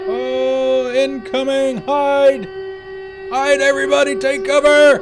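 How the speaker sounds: high, loud mock alarm shout. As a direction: yell a playful raid warning. Streamer greets raid, mock alarm.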